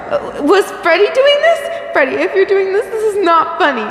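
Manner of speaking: high, frantic voice near tears; panicked sobbing. Acted. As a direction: plead as if crying in fear. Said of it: "You sobbed aloud".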